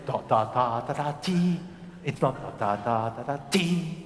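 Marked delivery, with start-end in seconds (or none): 0.1-1.6 even da syllables, lift on the last ti; right rhythm. Shown as a demonstration. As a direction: tap evenly, rise at the end. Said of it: "That's what it is".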